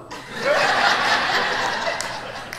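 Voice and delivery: a high airless burst of laughter; high breathy laugh. Real, not acted. Laughing at the pastor's idea, a high airless burst.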